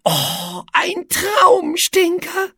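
a little monster swooning over a stink; delighted monster. A narrator's reading. Monster Etze delighted by smell. (begeistertes kleines Monster)

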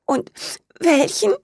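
high, tearful little question; sniffling question. A narrator's reading. Fairy asks the old man through tears. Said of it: "fragte sie schniefend".

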